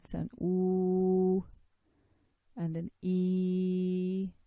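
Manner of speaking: "oo" and "e" held very long and slow; a long drawn "oo", then a long "e"; held vowel demo. Shown as a demonstration. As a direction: sustain each vowel from the chest. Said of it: "So the sounds".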